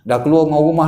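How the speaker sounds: usual speaking voice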